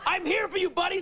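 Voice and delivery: very high, emotional eagerness; eager reassurance. Retold or imitated. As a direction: rush to a friend's aid, hammed-up. Reenacts eagerly answering the caller.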